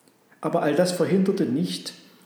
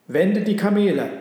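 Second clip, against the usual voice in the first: loud, raised-pitch order; commanding; 6 semitones higher, 7 dB louder than usual. A narrator's reading. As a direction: give a forceful merchant's command. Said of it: "befahl der Kaufmann".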